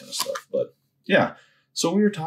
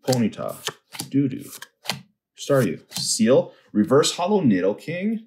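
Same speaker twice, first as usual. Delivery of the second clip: normal talking voice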